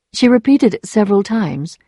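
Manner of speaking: usual speaking voice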